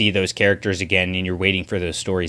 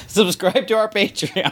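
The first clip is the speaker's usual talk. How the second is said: mock announcer; 10 semitones higher than usual. Joins the joke video voice.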